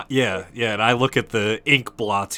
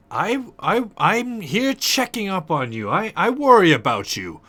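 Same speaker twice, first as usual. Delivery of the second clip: higher, hesitant, stammering in-character voice; concerned visitor; 7 semitones higher than usual. Acted. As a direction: stammer out worry in a raised pitch.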